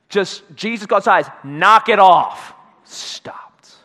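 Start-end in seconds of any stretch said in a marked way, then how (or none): 1.4-2.5 slow, loud command to the storm; Jesus silencing the storm, slow and loud; commanding rebuke. Retold or imitated. 2.8-3.8 hushed whisper as the storm dies. Acted. Storm suddenly stops.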